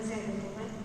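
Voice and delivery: softly, surrendering to God; quiet tender prayer. Retold or imitated. Retold prayer of repentance. (doa pelan dan lembut penuh penyesalan)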